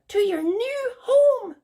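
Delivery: very high, prim bird voice; Sylvia the bird. Acted. Haughty bird correcting Rapunzel, very high voice. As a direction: squeak it out like a haughty little bird. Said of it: "said Sylvia".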